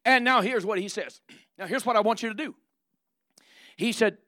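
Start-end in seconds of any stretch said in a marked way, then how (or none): none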